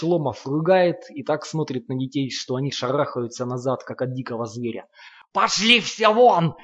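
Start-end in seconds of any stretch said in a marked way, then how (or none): 5.3-6.5 slow, much higher and louder roar; furious yell. A narrator's reading. Lomov roaring at the children to get out, furious yell. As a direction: bellow it at the kids to drive them out. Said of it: "орёт на них Ломов".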